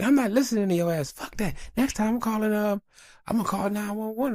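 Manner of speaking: high, hammed-up angry wife voice; lightly voiced mad wife; mock angry wife. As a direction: imitate the wife laying down the law. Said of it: "Then she got mad".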